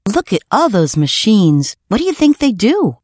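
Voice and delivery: put-on high, curious little-boy voice; marvel, then ask in a high voice; excited child. PJ to Taffy at the post office machines, excited.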